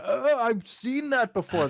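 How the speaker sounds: high, loud, drawn-out mimicry of a delighted moviegoer; exaggerated slow "oh" of recognition; mock audience recognition.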